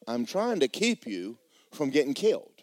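normal talking voice

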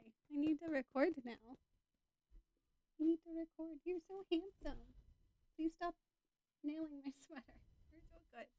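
high-pitched talking to a doggy; doting dog talk. Real, not acted. Podcaster to dog nailing the sweater, doting high pet talk. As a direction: coo praise at the dog in a high voice. Fussing over the dog before recording.

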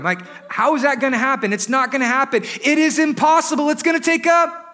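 exasperated disbelief. Acted. Disciples insisting they can't feed the crowd, exasperated. Disciples' sarcastic protest, building.

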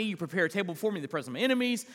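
psalm rattled off very fast; rushed recitation. Acted. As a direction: race through the verses. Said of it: "I said that real fast".

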